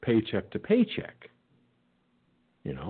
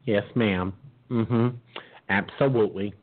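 ordinary delivery

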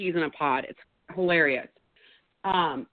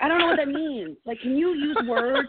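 exasperated yelling; 6 semitones higher than usual. Retold or imitated. Yelling at the miming woman that she can't hear her, exasperated. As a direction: shout through the glass, losing patience. Frustrated shouting at the car window.

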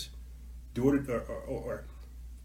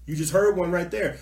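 ordinary talk, nothing marked

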